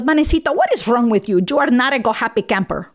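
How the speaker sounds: exasperated mock Colombian mom; imitating Mom's Colombian accent. Mom asking her down daughter what's wrong, Colombian accent. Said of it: "And she goes".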